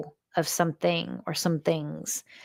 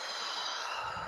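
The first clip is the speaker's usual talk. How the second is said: soft, breath-only sigh blown out; long releasing exhale; 15 dB quieter than usual. Shown as a demonstration. Leader models a releasing breath for the group, long exhale. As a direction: blow the breath out softly and fully. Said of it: "blow it out".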